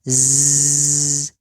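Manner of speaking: z held as a thin, hissing buzz with the jaw thin; thin zai. Shown as a demonstration. Teacher showing students the thin zai, hissing z. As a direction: keep the z sound thin and flowing.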